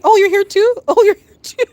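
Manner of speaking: mock surprise. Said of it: "and we're like".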